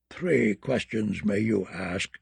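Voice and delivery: put-on low, soft muttering old crone; terrible deep voice. A narrator's reading. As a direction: mutter like an ancient witch. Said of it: "the crone said".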